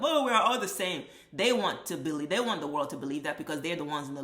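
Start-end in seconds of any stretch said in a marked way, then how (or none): 0.0-1.0 higher, mocking sing-song sameness claim. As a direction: parody the sales pitch with a mocking lilt. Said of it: "sell you on this concept".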